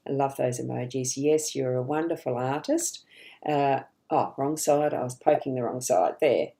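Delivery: usual speaking voice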